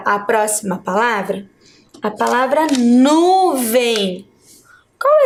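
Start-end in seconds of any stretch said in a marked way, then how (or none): 2.8-4.3 "nuvem" slowly, with a long strong "nu"; stress on NU. Shown as a demonstration. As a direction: hold the "nu" in "nuvem". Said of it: "a sílaba nu". ("nuvem" devagar, com o "nu" alongado)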